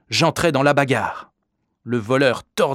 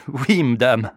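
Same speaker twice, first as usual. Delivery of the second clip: sniggering; 2 semitones higher, 4 dB louder, slower than usual. A narrator's reading. Father to the lady asking if he lives there, sniggering. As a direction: answer with a mocking chuckle. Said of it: "Mon père ricana".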